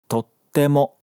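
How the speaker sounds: "Tottemo" with a short silent gap between "to" and "te"; geminate pause. Shown as a demonstration. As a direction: hold the gap before the t in "Tottemo". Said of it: "vazio de pronúncia entre o to e o te". ("Tottemo" com pausa entre o to e o te)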